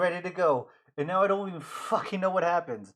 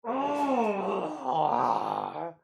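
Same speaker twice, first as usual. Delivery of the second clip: normal talking voice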